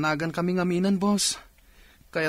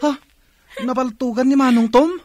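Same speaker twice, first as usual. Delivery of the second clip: shocked disbelief; 8 semitones higher, 7 dB louder than usual. Acted. Hears coworker's brother was shot.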